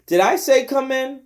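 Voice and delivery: scolding. Acted. Della scolding Nignag.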